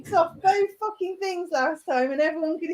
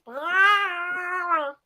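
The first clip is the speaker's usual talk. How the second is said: Chewbacca growl; 2 semitones higher, 3 dB quieter than usual. Retold or imitated. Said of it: "He goes".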